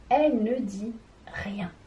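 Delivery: whispered, as if keeping a secret; whispered to show that the last E makes no sound. Shown as a demonstration. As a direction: hush it like the silent letter. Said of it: "Madame E qui se cache derrière".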